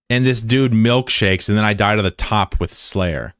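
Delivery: ordinary delivery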